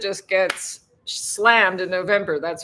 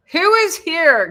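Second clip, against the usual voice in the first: calling out; 9 semitones higher, 9 dB louder than usual. Real, not acted. Calling out to unseen viewers.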